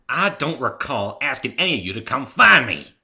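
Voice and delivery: loud, cutting comeback; cold retort. Acted. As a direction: push back hard. Heated quarrel between friends.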